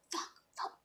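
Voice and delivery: barely audible, breath-only swearing; whispered curse. Retold or imitated. Cursing inwardly at work, very faint whisper. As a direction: whisper the expletive to yourself. Silent curse inside her head.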